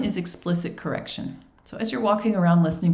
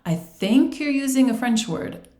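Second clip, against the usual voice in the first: teacher's tactful hint; 8 semitones higher, 4 dB louder than usual. Acted. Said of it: "teacher can say".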